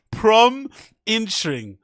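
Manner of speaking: "Prom" rising, then "Intring" falling, as one clipped word; mocking "Promising" as "Prom Intring"; imitating Brendan's pronunciation. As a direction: split "Prom ... Intring" with a pause. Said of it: "that's how Brendan pronounces interesting".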